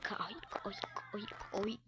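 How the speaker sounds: whispered urgent "corre"; being chased. Real, not acted. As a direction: hiss it under your breath in panic. Fleeing, whispered.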